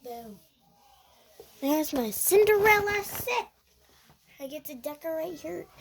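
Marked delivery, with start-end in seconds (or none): 1.6-3.5 high thrilled announcement; excited loud. Real, not acted. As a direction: show it off with excitement. Excited reveal of toy.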